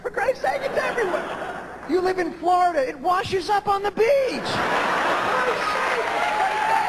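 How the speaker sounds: exasperated rant climbing to a high-pitched yell that breaks into laughter. Pretend. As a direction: build it up to an incredulous yell. Ranting about pot everywhere.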